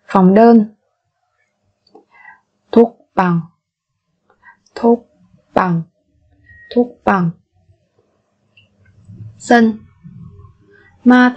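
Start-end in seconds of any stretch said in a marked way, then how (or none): 4.8-5.8 slow, separated syllables for learners; careful teacher's slow model. Shown as a demonstration. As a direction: spell it out slowly, beat by beat.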